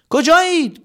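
high loud call. Acted. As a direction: shout the question into the dark. Calling out.

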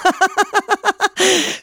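high, bright hearty laugh. Acted. Scarecrow laughing at the swallow's worry, high laugh. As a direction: burst out laughing with relief. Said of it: "مترسک خندید".

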